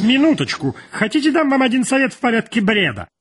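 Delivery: very high, fussy character voice; high-voiced editor. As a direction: pitch the advice far above your own voice.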